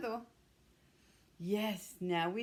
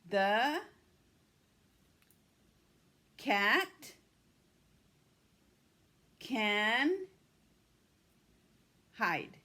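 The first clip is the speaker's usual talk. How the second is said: slow, louder word-by-word model; echo model; 2 semitones higher, 5 dB louder, much slower than usual. Shown as a demonstration. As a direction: say it for the class to echo back. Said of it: "You're gonna echo me".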